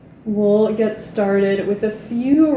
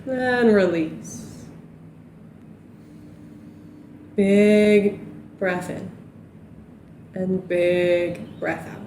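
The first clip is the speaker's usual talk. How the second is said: guided relaxation. Acted. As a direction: ease them down into stillness. Guides a cool-down.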